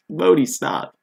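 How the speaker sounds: loud, high cry to stop; exclaimed protest. Acted. Teacher telling Modi to stop, exclaimed protest. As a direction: cut in with a high, loud objection. Exasperated outburst after a laugh.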